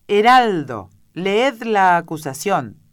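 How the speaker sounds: loud, high, drawn-out order; ringing command from a king; royal command. A narrator's reading. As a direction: proclaim it to the whole court. King orders the herald to read.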